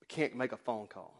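ordinary talk, nothing marked

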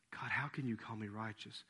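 soft, low, humble question; humbled. Acted. Someone humbled by grace asks God, humbled and hushed. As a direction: ask it softly and low, humbled. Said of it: "humble yourself enough to say".

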